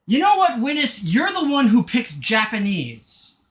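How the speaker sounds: playful accusation. Real, not acted. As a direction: turn the blame back on them, loud and high.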